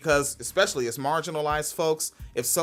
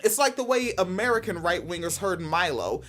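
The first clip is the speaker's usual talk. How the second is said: ordinary talk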